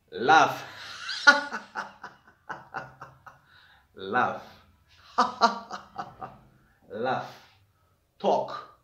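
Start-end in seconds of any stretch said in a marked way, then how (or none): 0.7-2.1 high, staccato put-on laugh; demonstrative laugh. Shown as a demonstration. Teacher miming the verb laugh for students, demonstrative laugh. 5.2-6.3 short burst of high fake laughter; demonstrative laugh. Shown as a demonstration. Teacher acting out laugh, demonstrative laugh.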